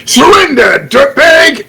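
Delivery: high, loud playful yell; playful shout. Acted. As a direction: bark it like a movie cop. Kid's playful cop shout.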